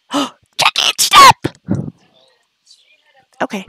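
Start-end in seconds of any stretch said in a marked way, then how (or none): 0.1-1.4 sudden high yelled stop, louder; sharp shout. Real, not acted. Yelling at her Sim Jackie to stop. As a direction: snap out a loud high stop.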